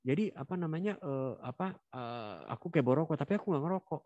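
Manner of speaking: put-on high, stammering kid caught out; high nervous child voice fumbling for an excuse; flustered child. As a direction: stumble over the excuse like a nervous boy. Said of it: "jawaban anak yang gelagapan".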